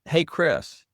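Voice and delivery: calling upstairs. Retold or imitated. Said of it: "she yells upstairs to an aide".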